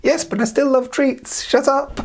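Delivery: high, slow granny voice; old grandmother. Acted. The grandmother answers the knock, high and frail. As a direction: call out like a frail old lady.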